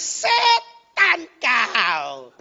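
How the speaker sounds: drawn-out loud curse in imitation; imitated angry yell. Driver Sabar curses the motorcycle rickshaw, mock furious yell. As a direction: yell it out, stretching each word. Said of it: "terus dia teriak".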